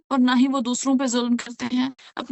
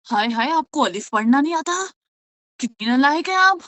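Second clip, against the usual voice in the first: hammed-up tut-tutting, louder at the end; mock scolding teacher; 4 dB louder than usual. Tajweed teacher mocking a beginner, scornful and louder. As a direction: belittle a new student with exaggerated dismay. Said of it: "اگر میں پہلے دن ہی بولوں".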